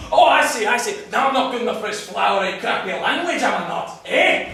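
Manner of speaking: loud, high, offended taunt; indignant and spoiling for a fight; angry sarcasm. Acted. Scotsman goads the Frenchman.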